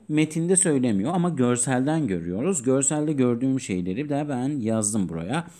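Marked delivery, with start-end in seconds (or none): none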